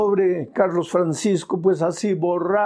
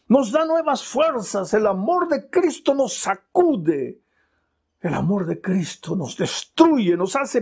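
building litany; 7 semitones higher than usual. Acted. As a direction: build each phrase with rising fervor. (letanía que crece en intensidad)

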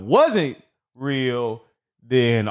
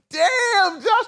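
"Damn, Josh" squeaked up high in disbelief; betrayed, high-pitched disbelief; stunned damn. Retold or imitated. Hung up on by Josh, high stunned disbelief.

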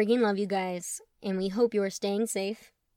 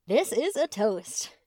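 slow and careful, trying to stay composed; steadied, deliberate toast; 3 dB louder, slower than usual. A narrator's reading. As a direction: hold the voice steady for the family. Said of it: "steady his voice".

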